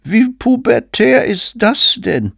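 high, loud, drawn-out mock howl; howling moral guardians. Moral guardians crying out at something pubescent, high mock howl. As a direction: wail it as an outraged prude. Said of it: "die Tugendwächter aufheulen".

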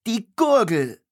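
high, loud, exasperated "Gurgel"; shouted name. A narrator's reading. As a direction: shout it at him.

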